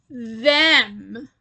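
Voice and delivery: mayor mocks the three friends, scornful. A narrator's reading. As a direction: sneer it out at the outsiders. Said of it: "he declared with a scornful laugh".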